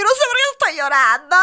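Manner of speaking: high, loud mock bawling; hammed-up tearful outburst; crying. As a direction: wail it as an exaggerated sob. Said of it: "llore y llore y llore".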